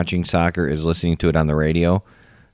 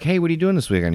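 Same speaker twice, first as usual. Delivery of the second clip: higher, lightly acted casual phone greeting; fake-casual; 9 semitones higher, 4 dB quieter, faster than usual. Prankster calls a Packer fan, fake-casual. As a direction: play innocent, pitched up.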